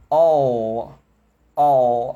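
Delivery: all with a long dark L; dark L demo. Shown as a demonstration. Teacher asking the class to watch his mouth, dark L. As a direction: draw out all, tongue back. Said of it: "Quando eu falo uma palavra como todo".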